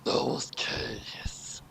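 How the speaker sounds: whispered aside. Real, not acted. Player reading to himself, whispered. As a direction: breathe it under the breath.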